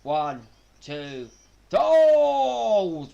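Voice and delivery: counting the pin, then a sudden high loud cry; shocked shout at near fall. Real, not acted. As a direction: yelp as the count breaks.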